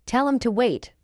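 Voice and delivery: quick, linked "Tell 'em to wait"; fast English. Shown as a demonstration. Teacher trains learners' ears, fast. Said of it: "fast English is not difficult".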